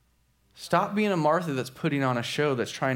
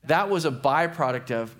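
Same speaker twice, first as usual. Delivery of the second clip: usual speaking voice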